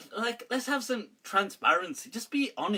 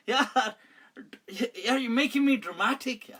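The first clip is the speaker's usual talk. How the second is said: laughing; 5 semitones higher than usual. Real, not acted. Laughing at own drama.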